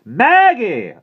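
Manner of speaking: very high, very loud holler; calling out. A narrator's reading. Aunt summons Maggie again, impatient loud shout. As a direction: yell the name through the house.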